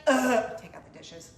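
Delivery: groan, then grumble the chore back; teen grumble. Retold or imitated. Daughter grumbling back at mom's chore request. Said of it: "teenage daughter does that".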